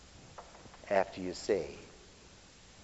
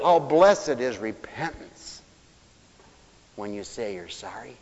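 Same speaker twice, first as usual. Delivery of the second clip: ordinary delivery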